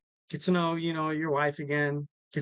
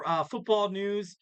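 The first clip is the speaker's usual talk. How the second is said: ordinary talk, nothing marked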